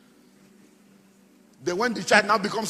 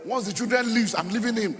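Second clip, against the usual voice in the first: higher, loud preacher's shout; shouted rebuke; 5 semitones higher, 6 dB louder than usual. Real, not acted. Pastor to self-deceived husbands, shouted rebuke. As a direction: hammer it at the congregation in full voice.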